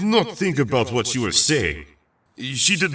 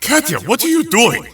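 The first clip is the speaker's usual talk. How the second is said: much higher and louder, alarmed; shocked call; 13 semitones higher, 5 dB louder than usual. Acted. Peter calls out to his friend caught fighting, alarmed. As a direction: stop her mid-swing.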